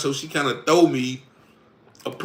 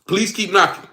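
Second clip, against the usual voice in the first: usual speaking voice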